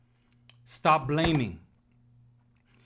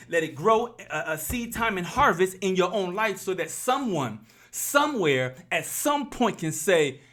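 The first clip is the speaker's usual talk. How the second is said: raised, driving preaching voice; 4 semitones higher than usual. Real, not acted. Vlogger urging viewers to drop excuses, preaching.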